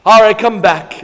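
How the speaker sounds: a salesman calling out; calling back loud. Retold or imitated. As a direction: shout it across the lot to stop them leaving. Dealer calling them back.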